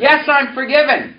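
calling out the line for echo; preacher's call line; congregation repeat-after-me. Shown as a demonstration. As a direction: lead the response loudly.

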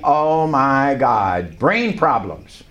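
slow, drawn-out inner groan; dismayed. Retold or imitated. Preacher acts out his own worried thought, dismayed. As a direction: stretch out each word in mock dread.

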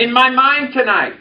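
calling out the line for echo; preacher's call line; congregation repeat-after-me. Shown as a demonstration. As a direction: lead the response loudly.

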